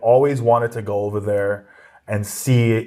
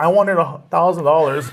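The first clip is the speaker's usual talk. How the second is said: laughing through the line, pitched up; laughing punchline; 5 semitones higher than usual. Retold or imitated. Comedian comparing his fee to [name]'s, laughing.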